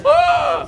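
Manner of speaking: loud high panicked yelp; high shriek. Retold or imitated. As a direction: let out a dainty shriek. Tailor shrieks at fire news.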